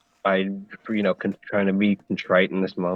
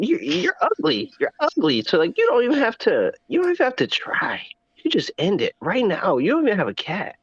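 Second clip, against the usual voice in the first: mocking high voice; 10 semitones higher than usual. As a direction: taunt him in a high, mocking voice.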